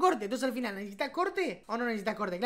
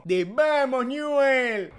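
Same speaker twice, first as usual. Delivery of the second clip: drawn-out catchphrase; 3 semitones higher, 8 dB louder, much slower than usual. Retold or imitated. Recalling Kiko's catchphrase.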